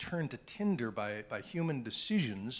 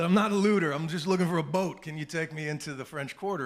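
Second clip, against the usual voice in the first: loud, higher-pitched urgent appeal; urgent plea; 4 semitones higher, 7 dB louder than usual. Retold or imitated. Reporter to boat dealer with a handgun, urgent plea. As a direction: call out quickly to calm an armed stranger. Pleading with man holding handgun.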